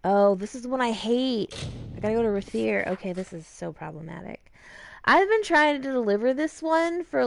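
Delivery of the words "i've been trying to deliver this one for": exasperated. Real, not acted. Venting about stuck quest.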